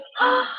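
sharp high gasp of wonder. Acted. Owen gasps at the snowflake in wonder. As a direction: draw in breath, amazed.

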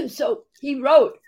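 coughing, voice catching; words squeezed out between coughs; choking on a drink. Real, not acted. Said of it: "went down the wrong pipe".

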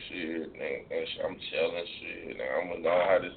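low, laid-back thug-chick voice; mimicking thug woman. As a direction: drop into a low imitation of a thug girl. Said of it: "I don't like no woman that talk like that".

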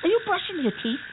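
high, incredulous scolding; can't believe she's brushing now; exasperated. Acted. Friend brushes teeth mid-rescue.

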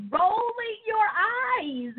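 drawn out. Acted. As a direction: stretch it out mockingly. Mocking stubborn church member.

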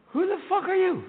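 high startled outburst; high-pitched hungover surprise; morning shock. Acted. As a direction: wake up horrified. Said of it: "that all-important question".

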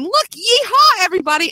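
a mom mimicking her delighted daughter's yeehaw; little girl cheering. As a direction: cheer like a kid riding a stick horse. Seven-year-old daughter in cowgirl hat.

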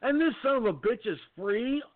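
high, heated outrage; venting real fury at the news; angry rant. Real, not acted. Outraged rant about knife attacker.

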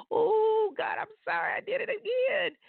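high-pitched whimpering plea; mock tearful apology. Penitent telling God sorry for a repeat slip, mock crying. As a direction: wail it like a sheepish sinner, hammed-up.

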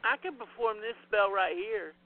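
usual speaking voice

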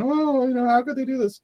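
high, whiny mock lament; mocking hand-wringing. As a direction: imitate someone wringing their hands.

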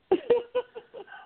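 high chuckling laugh. Real, not acted. Amused at the chair-throwing story, laughing. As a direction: chuckle high in short bursts.

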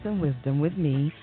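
ordinary delivery

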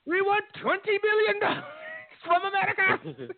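high, put-on captor voice; squeaky hammed-up hostage-taker; mock ransom demand. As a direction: play the kidnapper demanding cash.

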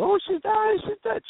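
high, panicked wail of a bystander; imitating frantic onlookers crying that she's dead; hysterical panic. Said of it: "everybody was hysterical".

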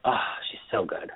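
breathy whisper of admiration; whispered gush. Real, not acted. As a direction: whisper it like a secret thrill. Gushing over the actress.

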